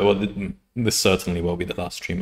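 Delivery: usual speaking voice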